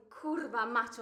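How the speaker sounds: loud burst of Polish swearing; brief, punched-out yell; acted shout. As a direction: shout the curse out.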